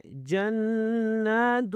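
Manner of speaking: جنات with a held nasal noon; slow tajweed ghunna in جنات. Shown as a demonstration. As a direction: chant جنات high and slow, stretching "naa". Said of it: "نون پر غنہ کرنا ہے".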